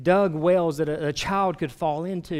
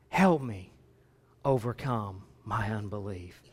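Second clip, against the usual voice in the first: halting plea, sinking low and soft; plea that drops to a hush; hopeful father pleading; 8 semitones lower, 5 dB quieter than usual. Acted. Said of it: "this hopeful father".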